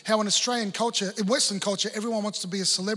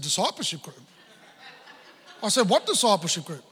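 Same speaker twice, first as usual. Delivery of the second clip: laughing disbelief. Retold or imitated. Retold disbelief at sister's news.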